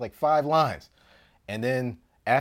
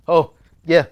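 excited reply; 6 semitones higher, 14 dB louder than usual. Retold or imitated.

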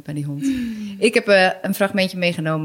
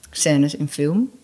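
ordinary talk

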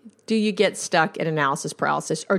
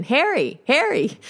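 retold calling; 8 semitones higher than usual. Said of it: "she was saying it over and over again".

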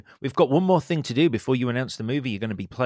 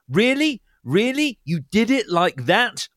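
higher, louder, slowing appalled repeats; mock appalled Kate Winslet; 8 semitones higher, 5 dB louder, slower than usual. Quoting actress mocking her own accent, appalled. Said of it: "she said in this interview".

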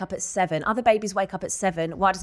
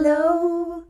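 sing-song hello; 11 semitones higher, 4 dB louder than usual. Pretend. Playful sing-song greeting.

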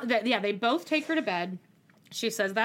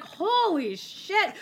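drawn out in disbelief; slow, stunned holy shit; 7 semitones higher, much slower than usual. Retold or imitated. Own stunned reaction.

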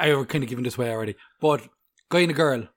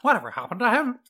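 higher, teasing Northern Irish imitation; mimicked Northern Irish accent; 8 semitones higher than usual. Friend mocking co-host's slipped accent, imitated Northern Irish. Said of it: "What was that accent?".

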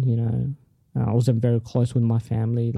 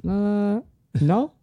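high, drawn-out "uh"; higher-pitched comic reluctance; hesitant denial; 8 semitones higher, much slower than usual. Retold or imitated. Denying the psychic's vision, for laughs.

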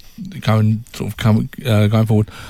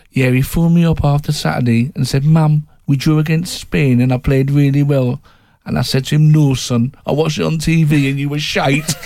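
higher-pitched put-on mum's accent, lightly done; Jordan Henderson's mum; 5 semitones higher than usual. Footballer's mum recounting her phone call with her son, imitated accent. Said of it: "excuse my accent here".

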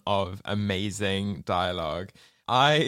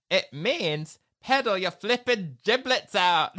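higher, blunt Yorkshire hen; Yorkshire accent; 9 semitones higher than usual. Retold or imitated. Bunty snapping at Babs in a mock Yorkshire accent. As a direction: bark it in a gruff Yorkshire accent. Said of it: "That Yorkshire accent".

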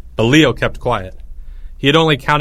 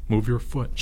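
soft groaned complaint; groaning; 7 dB quieter than usual. A narrator's reading. Albert squashed by the others, groaning. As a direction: grumble it under a groan. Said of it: "groaned Albert".

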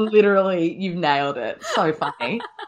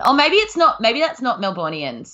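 ordinary delivery

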